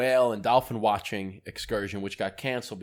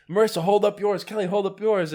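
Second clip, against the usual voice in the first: raised, higher voice giving excited orders; retold rallying call; 10 semitones higher, 6 dB louder than usual. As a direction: call out to friends as if rallying them.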